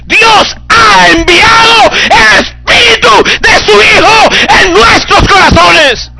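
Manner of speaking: high, drawn-out full-force scripture shout; fervent shouting. Real, not acted. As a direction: proclaim the verse at the top of your voice. Shouts the verse to congregation. (proclamación a gritos)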